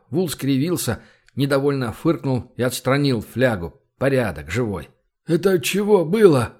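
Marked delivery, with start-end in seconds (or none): 5.2-6.5 startled, high and loud question; dazed, louder and higher; surprised. A narrator's reading. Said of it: "удивлённо спросил он". (удивлённо)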